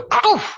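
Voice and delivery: high, loud explosive "poof"; imitated strike noise. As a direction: burst it out like a sudden blow. Imitates his instant hit back.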